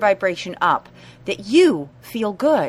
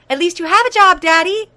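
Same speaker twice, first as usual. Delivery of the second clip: higher, louder, chirpy put-on cheer; brightly declared; 9 semitones higher, 9 dB louder than usual. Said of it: "brightly declare".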